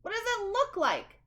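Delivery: high, loud, exasperated comeback; grumpy bird snapping loudly; irritated retort. Acted. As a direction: make it sound obvious and annoyed.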